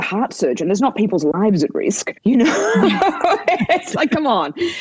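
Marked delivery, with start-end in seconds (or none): 3.7-4.5 high laugh through the words. Real, not acted. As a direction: giggle it out, amused. Laughs at her own joke.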